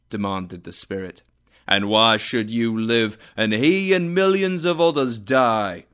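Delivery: the spirit scolding Scrooge, loud and stern; angry spirit. Acted. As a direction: rebuke him with cold anger. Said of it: "The spirit spoke angrily to Scrooge".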